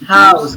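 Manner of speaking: "House" with the H sounded like a Spanish jota. Shown as a demonstration. Teacher confirming the answer, "House" with H like J. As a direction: say "House" with an audible H at the start. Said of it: "suena como una J".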